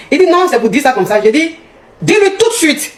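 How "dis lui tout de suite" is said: high-pitched, acted command; jealous partner laying down the law; insistent order. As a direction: demand it at once, pitch jumping up. Retold order to boyfriend during quarrel.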